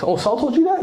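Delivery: high-pitched questioning echo, retold; surprised priest. Priest checking David's story with him, mildly surprised. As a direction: act out a priest checking a claim.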